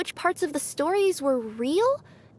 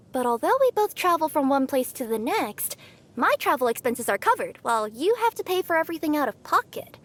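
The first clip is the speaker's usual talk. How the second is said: normal talking voice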